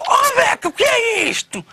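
a high shout of alarm; shocked shout. Retold or imitated. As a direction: yelp it out in shock. Teller acts his shock, shouting.

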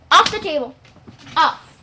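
loud sharp order to the dog; firm loud command. Real, not acted. Girl ordering her dog off the table, loud and firm.

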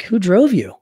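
jolted by the car pulling up; startled question. Retold or imitated. A father startled at his son's driver, asking sharply.

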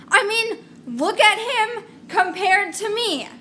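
high, loud protest; exasperated complaint.

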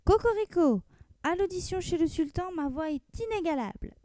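high, proud crowing rooster voice; boastful rooster, pitched high. Acted. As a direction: crow it out like a vain rooster. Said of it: "le coq se mit à crier".

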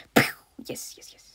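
normal talking voice

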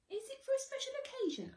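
high, fast fussy shopkeeper; chirpy high salesman; shop assistant voice. Acted.

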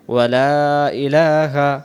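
slow chanted phrase; slow recitation. Shown as a demonstration. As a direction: draw it out. Recites phrase before translating.

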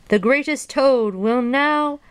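high, drawn-out grand introduction; announcer voice. A narrator's reading. Strange voice begins another introduction for Toad, announcer voice. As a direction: announce the star, stretching it out. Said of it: "Then the voice said".

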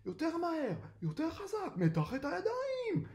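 high-pitched, strained coaching yell; swimming instructor's high shout. A narrator's reading. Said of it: "ובוזו צועק".

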